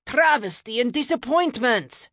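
high, loud theatrical lament; groaning dismay. A narrator's reading. Godson let down by the magic mirror, groaning dismay. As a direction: lament it like a fan whose team just lost. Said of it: "Leif groaned like he was watching".

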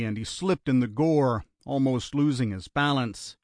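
ordinary delivery

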